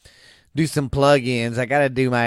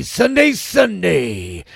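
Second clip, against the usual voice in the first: monster-truck promo; 4 semitones higher, slower than usual. Acted. Motocross ad hype.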